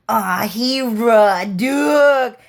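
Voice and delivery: slow loud mock proclamation; drawn-out exclaim. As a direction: stretch it out like a big reveal. Retelling his own excited outburst.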